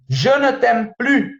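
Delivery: "Je ne t'aime plus" said slowly in French, "plus" as "plu"; slow French example. Shown as a demonstration. ("Je ne t'aime plus" devagar, com "plus" sem o S)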